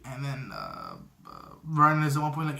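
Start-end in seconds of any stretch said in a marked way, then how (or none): none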